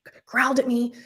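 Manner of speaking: mildly urgent retold insistence; insistent repeat. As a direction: act out repeating it audibly, still rattled. Repeats it to puzzled husband.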